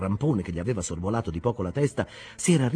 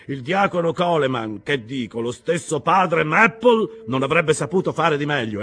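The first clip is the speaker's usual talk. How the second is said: higher, louder rough skipper; hearty harsh captain; 8 semitones higher, 6 dB louder than usual. Acted. Captain Peleg welcoming them aboard, loud and hearty. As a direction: call out like an excited old salt. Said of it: "la voce aspra del capitano Peleg".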